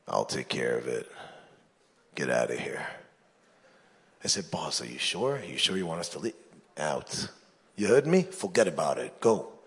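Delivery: low, quiet and menacing; mob boss. Acted. Big boss dismissing his goons, low and quiet menace.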